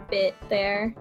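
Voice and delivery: ordinary delivery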